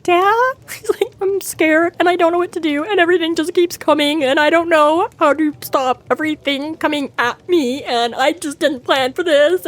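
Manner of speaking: high, imitating a boy near tears; mock-tearful frightened plea to dad; lightly voiced teary son; on the verge of tears. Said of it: "this on the verge of tears".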